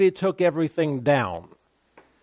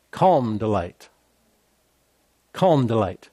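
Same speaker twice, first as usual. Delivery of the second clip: much lower, settled and calm; 6 semitones lower than usual. Shown as a demonstration. Preacher modelling calm delight after mocking panic. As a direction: bring it down to a calm hush. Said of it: "models calm after mock panic".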